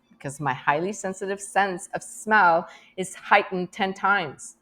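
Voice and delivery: ordinary talk, nothing marked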